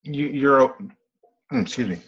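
sputtering through a choke; coughing on beer mid-sentence; choking on a drink. Real, not acted. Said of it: "I was just choking on my beer".

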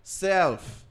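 upbeat announcer ad read. Acted. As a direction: sell it like a radio spot.

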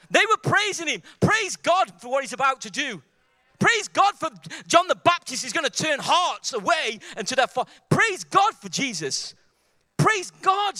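high, loud exultant proclamation; excited preaching. Real, not acted. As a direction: get carried away praising, voice soaring. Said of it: "I'm getting excited about praising".

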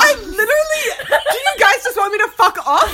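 loud laughing shout. Real, not acted. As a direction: yell it while cracking up. Laughing, mock outrage at friends.

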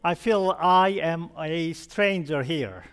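ordinary talk, nothing marked

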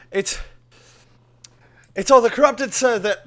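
put-on high, loud panicky soldier in a failed Russian accent. Frantic guard on a stone reports to king, botched Russian accent. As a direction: attempt a Russian accent, then lose it. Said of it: "that's not a Russian accent at all".

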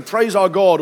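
loud, high summons to praise; voice from the throne. A narrator's reading. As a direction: proclaim it as a command from on high. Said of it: "from the throne came a voice saying".

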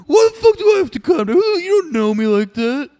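very high, shrieked mock outrage; imitated drunken screaming. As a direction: scream back like an offended drunk. Drunk friend screaming back at speaker.

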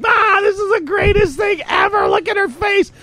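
gleeful squeal. Retold or imitated. Friend gloating over a humiliated partner, shrieking with glee. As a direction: voice jumps way up, thrilled. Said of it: "Mew is like".

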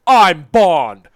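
loud, hammed-up non-impression of Connery. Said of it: "talk louder".

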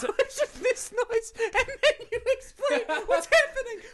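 high laughing voice. Real, not acted. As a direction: talk while cracking up. Laughing through explanation.

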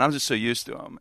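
normal talking voice

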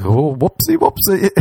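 put-on high, sing-song baby whoopsie; cutesy whoopsie. Sober hand job ending, high cutesy whoopsie. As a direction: coo it like a toddler's oops.

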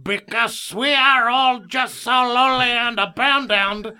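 put-on high, loud, slow Fantasy Island accent; talking cow with a drawn-out, high Tattoo accent; Tattoo's accent. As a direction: plead slowly in a hammed-up Fantasy Island accent. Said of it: "He had a little accent".

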